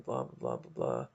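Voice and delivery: lightly voiced jaded star; mock bored grumble. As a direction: drone it like a tired celebrity. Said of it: "he's like".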